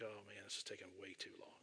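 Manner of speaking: exasperated under-the-breath complaint; impatient muttering. Retold or imitated. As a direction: mutter it, watching the clock. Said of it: "I'm like".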